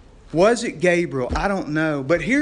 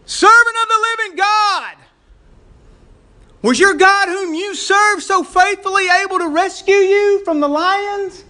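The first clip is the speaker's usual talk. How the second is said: high, loud desperate calling out; king calls out in anguish to Daniel in the lions' den; anguished cry; 7 dB louder than usual. Acted. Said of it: "he called out in anguish".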